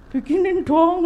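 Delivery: a hint of a spoiled child asking. Mother imitating her child asking her to cook, lightly. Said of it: "aku bilang".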